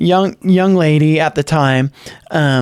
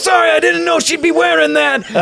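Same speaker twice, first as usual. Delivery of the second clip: high, shouted mock dad embarrassed on stage; 10 semitones higher than usual. Rock star father razzing his daughter onstage, high shout. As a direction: yell it like a rocker teasing his daughter. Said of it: "I'm sorry for the horrible impersonation".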